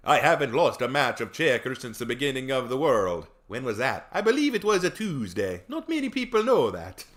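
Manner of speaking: mock-solemn Swedish character voice; Swedish-accented Death. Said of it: "Swedish accent comes from when we saw Death".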